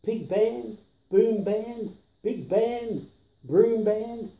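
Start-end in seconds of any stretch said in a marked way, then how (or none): none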